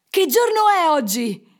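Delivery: loud, high excited shout; calling out. A narrator's reading. Scrooge calls to a boy from the window, shouting. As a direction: call down to someone in the street. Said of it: "gridò Scrooge chiamando un ragazzo".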